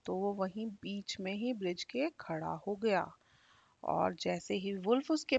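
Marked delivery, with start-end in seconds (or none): none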